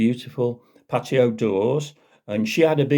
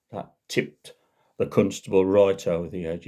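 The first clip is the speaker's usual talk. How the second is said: normal talking voice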